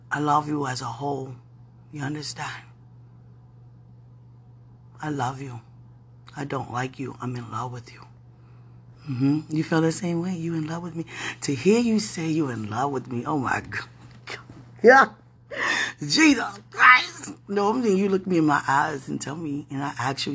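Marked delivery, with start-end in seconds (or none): 0.1-8.0 tender. Real, not acted. Tender late-night love confession. 14.8-17.3 tearful. Real, not acted. Overwhelmed by "I love you.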